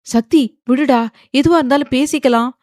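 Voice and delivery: high, loud, urgent appeal; desperate pleading. A narrator's reading. Father falls at attacker's feet, desperately pleading. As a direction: beg him loudly to let go. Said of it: "அவன் காலில் விழுந்தார் வசந்த்".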